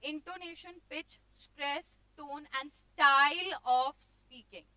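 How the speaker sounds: energetic reading, building to a loud finish; lively teacher voice, stretched and emphatic at the end; enthusiastic teaching. Shown as a demonstration. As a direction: read it with gusto to wake up the class. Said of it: "enthusiasm create होगा".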